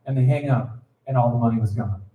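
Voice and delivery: ordinary talk, nothing marked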